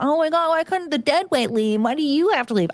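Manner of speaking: high-pitched, whiny imitation of griping; mock complaining. Imitating coworker griping about her quitting, mock complaining. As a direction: lift the voice into an exaggerated moan. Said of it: "somebody bitching and complaining about".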